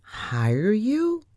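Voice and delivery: indignant, high rising question; startled cry, no real shout; incredulous cry. A narrator's reading. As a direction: echo it back in disbelief. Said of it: "I cried".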